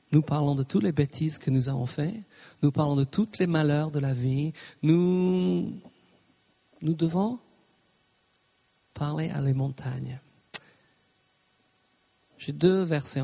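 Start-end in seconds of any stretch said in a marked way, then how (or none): none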